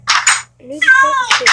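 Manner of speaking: high, loud, exasperated repeat; loud whiny shout. Real, not acted. Vlogger repeating his craving, now high and loud. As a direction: belt it out in frustration.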